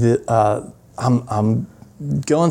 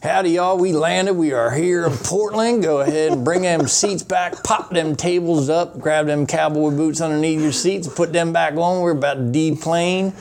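higher Southern country twang on the mic; country accent; 5 semitones higher than usual. Retold or imitated. Landing announcement in Portland, in a playful Southern country accent. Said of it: "I did a different accent".

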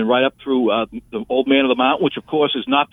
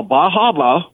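Downeast Maine local pronunciation; Maine accent; 3 semitones higher, slower than usual. Retold or imitated. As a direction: say Bar Harbor like a Mainer. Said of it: "as they say up there".

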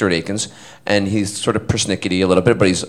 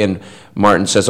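usual speaking voice

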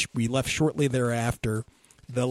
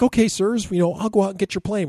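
put-on higher, louder, quick eager kid voice; kid's voice; 8 semitones higher, 5 dB louder than usual. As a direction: play the helpful boy, quick and eager. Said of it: "He's like".

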